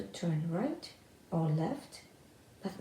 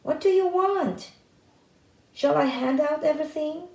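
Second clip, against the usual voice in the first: much higher, much louder shouting; loud call; 8 semitones higher, 9 dB louder than usual. A narrator's reading. Tom shouting deliberately loud inside the house to alert everyone. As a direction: bellow it to wake the house. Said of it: "he called out in a loud voice".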